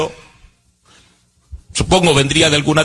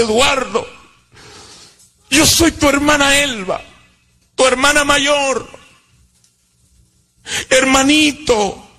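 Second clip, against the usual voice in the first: imitating a sister's tearful reunion, high and slow at the end; sister's tearful plea; 7 semitones higher than usual. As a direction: voice the sister breaking into tears, higher. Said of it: "Y comenzó a llorar". (la hermana rogando entre lágrimas, aguda y lenta)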